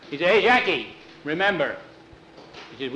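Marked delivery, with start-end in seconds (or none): 0.3-1.8 loud, high hail; calling out. Acted. Jose leaving, turns to remind Jackie, calling out. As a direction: shout back to him in parting.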